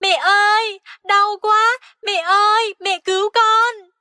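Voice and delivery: a little frog wailing for his mother; crying out in pain. Acted. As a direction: scream for mom while being pinched. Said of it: "Ếch xanh kêu to".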